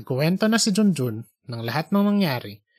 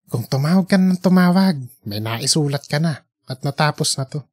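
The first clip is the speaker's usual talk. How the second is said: scolding himself. Acted.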